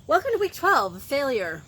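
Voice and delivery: high, loud retake of an intro; show host announcing. Acted. Host introducing week twelve's failure theme, loud announcer style. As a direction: proclaim the week's theme at full projection.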